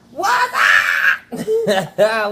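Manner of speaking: a big high laughing shout of greeting. Real, not acted. Host greets the viewers with a high, laughing shout. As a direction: yell the hello up high through a laugh.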